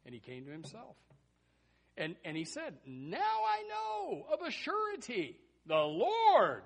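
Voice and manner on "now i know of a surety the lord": high, drawn-out shout of realisation; acted proclamation. Peter coming to himself after his escape, loud slow proclamation. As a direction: proclaim it slowly and loudly, stretching the words.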